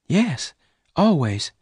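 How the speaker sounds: put-on a shy girl unsure of her past; Bess voice. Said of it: "Bess replied".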